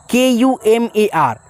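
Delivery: each letter spelled slowly and clearly; teacher spelling the word out slowly. Shown as a demonstration. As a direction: dictate the letters one by one. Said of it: "यहाँ पे आप आर लिखेंगे".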